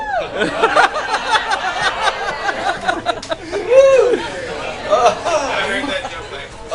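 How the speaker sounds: long, high real laughter after a heckle. Real, not acted. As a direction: crack up at the crowd's remark.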